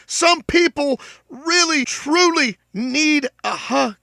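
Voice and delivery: high, slow, close to tears; choking up on the words; emotional. Real, not acted. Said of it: "That sounded pretty weak".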